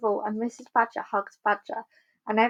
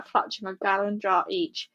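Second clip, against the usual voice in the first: usual speaking voice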